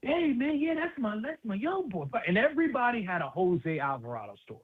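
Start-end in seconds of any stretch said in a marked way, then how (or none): 0.0-2.1 higher, lightly voiced proud older guy; Fat Hector voice. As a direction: do a quick take on a proud neighborhood elder. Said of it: "But Fat Hector was like".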